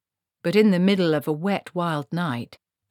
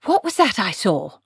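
sharp demand, higher and louder; 4 semitones higher, 5 dB louder than usual. A narrator's reading. Granny demanding of the wizard what she saw, insistent. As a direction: demand an answer at once. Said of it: "demanded Granny".

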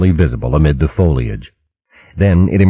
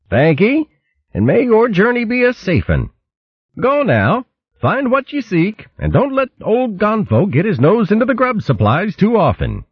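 much higher, cheery rustic voice; beaming goodbye with a joke; hearty otter farewell. A narrator's reading.

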